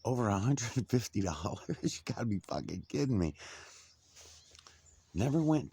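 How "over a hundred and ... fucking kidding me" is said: laughing disbelief. Retold or imitated.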